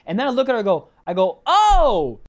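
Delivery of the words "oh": loud, higher drawn-out "oh"; retold big "oh" of sudden understanding; mock realization. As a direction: draw out the "oh" pointedly. Retold sarcastic realization to neighbor.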